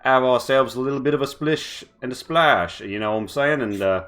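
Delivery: lightly voiced cheery British rogue; a hint of a tipsy Cockney farewell. Hatter bidding the party goodbye, cheery British voice. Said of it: "and he's like".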